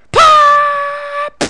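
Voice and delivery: loud, very high held cry ending in a pop; long battle wail. Pretend. Player striking an enemy, mock martial-arts wail. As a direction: wail up high, then hit.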